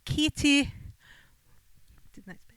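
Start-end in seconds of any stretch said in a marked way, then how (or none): 0.0-0.7 copied Scottish accent, higher pitch; attempted Scottish accent. Shown as a demonstration. Co-host repeats the name back, attempted Scottish accent. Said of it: "I repeat it back".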